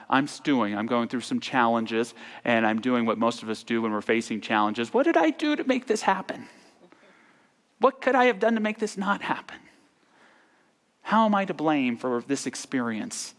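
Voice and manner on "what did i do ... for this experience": high-pitched mock-anxious fretting; fretful self-questioning. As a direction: play up the worried self-blame, pitched way up. Acting out anxious self-blame.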